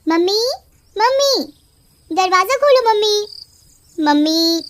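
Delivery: witch mimicking Pinky. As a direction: lure the mother out as her daughter. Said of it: "चुड़ैल पिंकी की आवाज में".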